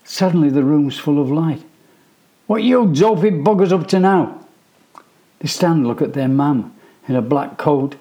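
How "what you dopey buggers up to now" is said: high, loud exasperated scolding; scolding mum. A narrator's reading. Mum bursts in and scolds her sons loudly. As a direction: tell the boys off.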